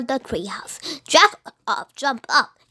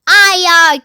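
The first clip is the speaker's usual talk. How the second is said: louder aye aye; pirate crew answers; loud pirate reply; 5 semitones higher, 10 dB louder than usual. Acted. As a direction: bark it back to the captain.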